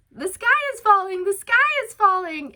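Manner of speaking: high, loud, frantic wail; mock panic. The panicking crowd mocked in a high, loud, hammed-up shriek. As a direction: ham up the panicking crowd crying doom. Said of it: "people are panicking".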